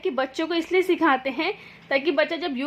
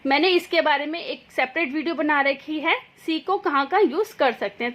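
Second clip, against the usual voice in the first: normal talking voice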